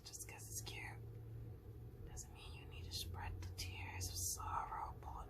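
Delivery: hushed mock-dramatic whisper; secretive playful whisper. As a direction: lean in and whisper the joke. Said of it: "whispered mock drama".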